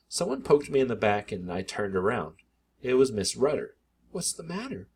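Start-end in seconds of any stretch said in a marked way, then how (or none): 4.1-4.9 much softer hushed question; whispering. A narrator's reading. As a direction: neighbor asks quietly in the elevator. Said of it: "she whispered".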